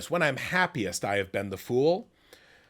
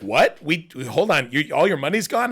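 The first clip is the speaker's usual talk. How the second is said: mock disbelief; 5 semitones higher, 6 dB louder than usual. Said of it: "And they're like".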